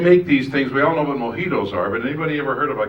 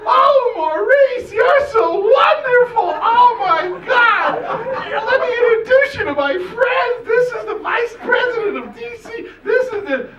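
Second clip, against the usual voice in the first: gushing fan squeal; 6 dB louder, slower than usual. Retold or imitated. Fangirl gushing at Maurice.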